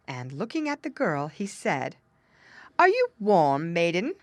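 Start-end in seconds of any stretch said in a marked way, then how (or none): 2.8-4.1 loud, imperious question; lightly voiced king, raised volume; King Frost demanding. A narrator's reading. King Frost questioning the stepmother's daughter, loud and demanding.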